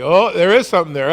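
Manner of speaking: frightened disciple seeing a ghost; startled fear. Acted. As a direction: spot a shape in the storm and panic. Disciples spotting the figure.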